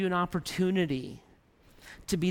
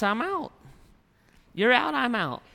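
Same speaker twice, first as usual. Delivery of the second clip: God walking out; 6 semitones higher than usual. Acted. God quitting on the people.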